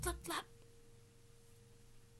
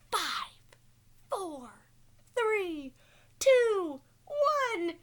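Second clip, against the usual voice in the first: countdown rising louder and higher; building countdown; 8 semitones higher, 10 dB louder, much slower than usual. Acted. Teacher counting down the snowplane launch, building.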